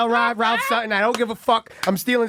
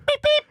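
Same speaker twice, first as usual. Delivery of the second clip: beep noise. Retold or imitated. Imitating a noise.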